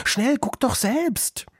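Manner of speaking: put-on very high, drawn-out girl's voice; urgent child's call. A narrator's reading. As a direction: urge them to look. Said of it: "rief das Mädchen".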